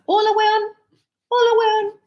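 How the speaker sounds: high, loud, cheery hola; excited greeting. Retold or imitated. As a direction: students greet each other, loud and high. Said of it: "they would come to class".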